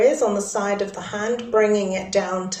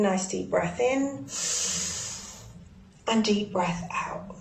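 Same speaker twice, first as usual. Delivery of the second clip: slow, soft breathing guidance; guided breathing; 7 dB quieter than usual. Acted. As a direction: lead them slowly through each breath.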